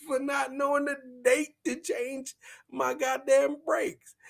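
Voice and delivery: high, loud indignant woman's voice; imitating bestie. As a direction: squeal it back at him, outraged. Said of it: "from her point of view".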